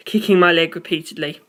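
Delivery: ordinary delivery, nothing marked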